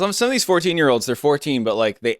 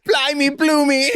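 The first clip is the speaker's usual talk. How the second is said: put-on Cockney accent; 13 semitones higher, slower than usual. Continuing the Cockney bit.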